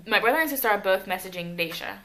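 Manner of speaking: ordinary delivery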